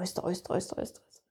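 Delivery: trembling fade. Retold or imitated. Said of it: "hele min krop ryster".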